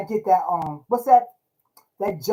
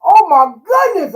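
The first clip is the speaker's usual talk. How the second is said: delighted exclamation; 10 semitones higher, 11 dB louder, slower than usual. Real, not acted. Delight recalling apple jelly cake.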